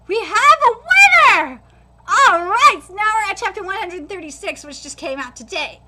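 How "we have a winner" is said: loud, slow proclamation; announcer call. Acted. Referee declaring the winner, loud. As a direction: boom it out like a ring announcer.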